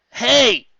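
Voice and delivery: high, loud hail across the beach; loud friendly shout; calling out. A narrator's reading. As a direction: call them over loudly. Calling out from afar.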